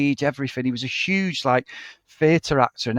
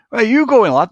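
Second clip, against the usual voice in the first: put-on loud, higher heckle in an old bloke's voice; mocking old bloke; 8 semitones higher, 7 dB louder than usual. Old bloke mocking a lad's leather jacket, loud jeer. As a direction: call it out as a jeer.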